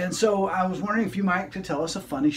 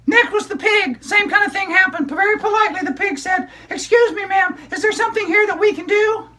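put-on high, bright child puppet storyteller; squeaky kid character excitedly telling a story; high-pitched puppet girl narrating; 14 semitones higher, 6 dB louder than usual. Puppet continues.

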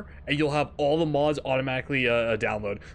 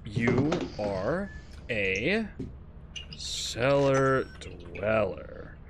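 slow and low, word by word; slow, spelled-out typing; 4 semitones lower, 5 dB quieter, much slower than usual. Real, not acted. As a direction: dictate it slowly as you type. Types the insult, saying it slowly.

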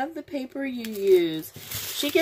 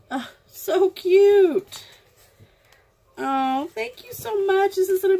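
choked up; 7 semitones higher, 4 dB louder than usual. Real, not acted. Touched by a friend's gift.

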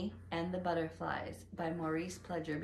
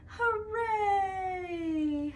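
cheering; 12 semitones higher, 6 dB louder than usual. Acted. Billy finds butterflies.

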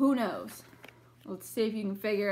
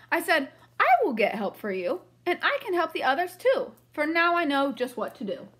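lively read-aloud storybook voice; reading to children; 6 semitones higher, 5 dB louder than usual. A narrator's reading. Teacher reading a picture book to the class, lively. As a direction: read it brightly for young listeners.

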